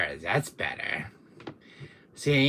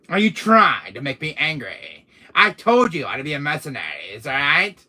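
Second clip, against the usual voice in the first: loud, higher indignant demand; angry; 4 semitones higher, 10 dB louder than usual. Acted. Villain scolding Capcom for leaving him out, angry. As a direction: raise your voice in mock outrage. Villain rages at game company.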